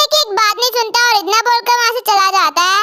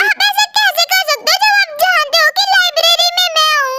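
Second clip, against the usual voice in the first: ordinary talk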